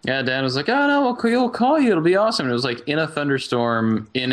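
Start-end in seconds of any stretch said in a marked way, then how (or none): 0.7-2.5 high, loud breezy reassurance; upbeat mock promise. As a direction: sell it like it's easy. Quoting Dan's confident promise.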